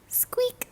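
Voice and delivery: very high, tiny squeak; mouse squeak. Shown as a demonstration. Teacher demonstrating animal sounds, very high mouse squeak. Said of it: "Animal sounds".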